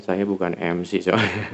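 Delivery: ordinary talk, nothing marked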